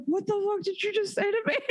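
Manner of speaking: high, stunned mock outburst through laughter; shocked boyfriend. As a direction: act out a boyfriend floored by dirty talk. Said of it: "he was like".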